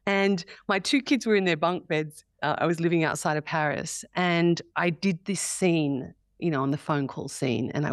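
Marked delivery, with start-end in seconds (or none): none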